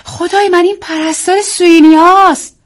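high, loud exclamation; wife crying out when she recognises the nurse's voice. A narrator's reading. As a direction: shout out in shocked recognition. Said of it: "آیرین فریاد کشید".

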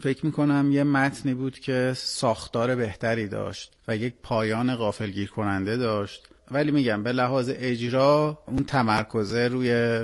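ordinary talk, nothing marked

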